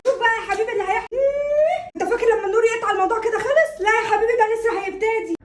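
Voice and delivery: high, heated quarrelling. Acted. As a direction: argue back with your voice up. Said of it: "وطي صوتك ده".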